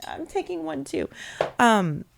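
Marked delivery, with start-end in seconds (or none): 0.1-1.1 high, soft playful aside; playful inner voice. Retold or imitated. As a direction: say it high, giving in to temptation. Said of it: "I've been looking at it going".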